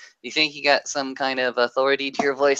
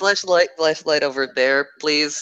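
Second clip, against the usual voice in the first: strained voice; 4 semitones higher, 4 dB louder than usual. Acted. NPC stuck asks for light.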